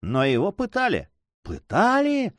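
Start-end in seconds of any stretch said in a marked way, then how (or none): 1.4-2.3 shocked, very high echoing question; crying out. A narrator's reading. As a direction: echo PЫТАЛИ high, in disbelief. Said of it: "вскричали беглецы". (потрясённый вскрик)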